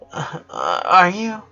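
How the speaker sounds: broken, breathy sobbing start; crying sister struggling to ask her brother. A narrator's reading. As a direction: choke out the words through tears. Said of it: "the breathing of a crying person".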